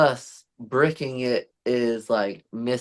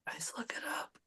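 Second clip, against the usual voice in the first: hushed whisper; 4 semitones higher, 21 dB quieter, faster than usual. Real, not acted. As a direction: whisper it conspiratorially. Conspiring aside.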